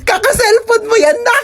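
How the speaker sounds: high, tearful scolding mother; crying mother. Acted. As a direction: wail at the kid like a worried mom. Reading a mother's comment. (umiiyak na nanay na nagsesermon)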